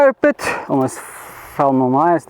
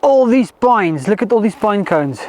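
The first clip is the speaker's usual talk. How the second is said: excited; 4 semitones higher, slower than usual. Real, not acted. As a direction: cheer at the find, then marvel slowly. Finds a pile of pine cones.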